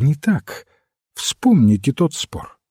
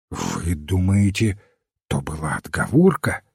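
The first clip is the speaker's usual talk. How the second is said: bewildered question; 7 semitones lower, 4 dB quieter than usual. A narrator's reading. Detective questions the priest's reasoning, bewildered. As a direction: ask it softly, at a loss. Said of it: "спросил растерянный собеседник".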